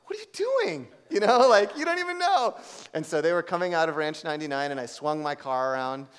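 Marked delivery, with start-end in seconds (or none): 0.1-2.6 high, loud incredulous what are you doing; God's rebuke. Retold or imitated. God chiding the speaker in his car, high incredulous rebuke. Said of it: "the Lord was like".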